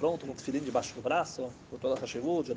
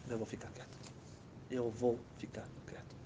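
whispered inner resolve, much softer; whispered to himself; 3 semitones lower, 12 dB quieter than usual. Retold or imitated. The man telling himself to stay silent, whispered. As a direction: hush it, holding yourself back.

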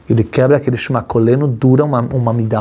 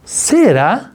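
surprised realization; much slower than usual. Retold or imitated. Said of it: "um cara fala".

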